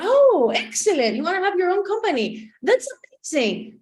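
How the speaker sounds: acted high-pitched enthusiasm; gushing coach. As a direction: gush like an impressed life coach. Imitating coach's delighted reaction.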